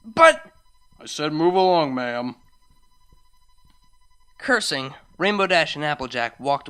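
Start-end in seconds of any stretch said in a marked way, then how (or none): 0.0-0.5 high, sudden objection; loud protest. Acted. Rainbow Dash objecting to security, protesting loudly. 1.0-2.4 loud, firm guard voice; stern order. Acted. Security guard moving the ponies on, ordering. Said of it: "ordered them to move along".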